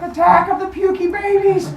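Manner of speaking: high-pitched mock panic cry; hammed-up high alarm; freaking out. Cronus freaking out as babies rise up, high mock panic. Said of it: "Cronus starts freaking out".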